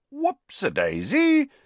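very high, slow singsong; mocking oops. A narrator's reading. Twin watching Ron slip with the knife, mocking. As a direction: sing it out in teasing mock concern.

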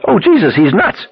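high-pitched cry of alarm; panicked scream. A narrator's reading. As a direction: scream it high, appalled at the madman. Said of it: "somebody screamed".

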